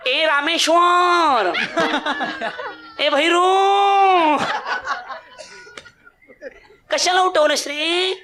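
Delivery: high, drawn-out sleepy whine from the wife; drowsy wife's whine. Retold or imitated. Wife grumbles at husband for waking her, high drawn-out whine. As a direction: whine like a pampered wife woken too early. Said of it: "तिची बोलण्याची system पुन्हा".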